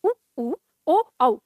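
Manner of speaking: "उ, उ, ओ, औ" sounded singly with rounded lips; rounded vowel demonstration. Shown as a demonstration. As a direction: pronounce "उ, उ, ओ, औ" one by one, lips rounded. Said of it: "लेकिन जब हम उच्चारण करते हैं". (गोलाकार होठों से "उ, उ, ओ, औ" का उच्चारण)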